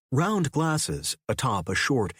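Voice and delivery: ordinary delivery, nothing marked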